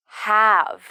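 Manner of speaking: "Have." drawn out into a buzzing final v; final V sounded. Shown as a demonstration. Teacher models the word have, clear final V. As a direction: hold the v that ends "Have.". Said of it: "Pay attention to the final V sound".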